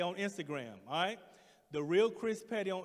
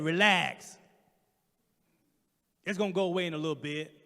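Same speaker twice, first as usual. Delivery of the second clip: short, louder dismissive order; curt nurse; 8 dB louder than usual. Retold or imitated. Impatient nurse brushing off the patient's pain. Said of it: "She said".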